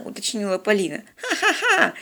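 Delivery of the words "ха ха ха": high-pitched mocking ha-ha-ha; old hermit's scornful cackle; contemptuous laugh. A narrator's reading. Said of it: "презрительно отозвался затворник". (презрительный смех)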